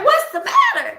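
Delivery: high voice of the worried boss; concerned boss on the phone. Retold or imitated. Imitating her boss asking why she's boohooing, high. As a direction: pitch it way up like the boss checking on her. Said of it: "He's like".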